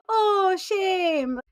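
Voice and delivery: "Oh, shame" high and sympathetic in a South African lilt. Retold or imitated. South African stranger cooing "Oh, shame" over good news, high and sympathetic. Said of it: "they would say it like".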